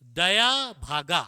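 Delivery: दया भागा said slowly and loudly, every syllable as written; spelling pronunciation. Shown as a demonstration. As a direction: read दया भागा out exactly as spelt, slow and loud. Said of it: "जो लिखा है वही pronounce करो". (दया भागा धीरे और ज़ोर से, जैसा लिखा है वैसा)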